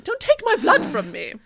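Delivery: high, loud hammed-up wail; hammed-up desperate shriek; mock panicked plea. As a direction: cry out as if someone is draining you.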